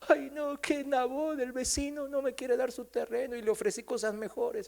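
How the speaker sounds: high, soft whining complaint; mock near-crying. King Acab complains to his wife about Nabot, fake tearful whine. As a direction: whimper it like a sulking child, hammed-up. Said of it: "casi llorando seguro".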